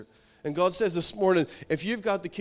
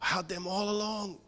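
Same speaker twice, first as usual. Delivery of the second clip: hushed, stunned murmur; heart-sinking realization; 2 semitones higher, 9 dB quieter than usual. Retold or imitated. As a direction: imitate a son softly realizing his mistake. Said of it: "the son's heart sank".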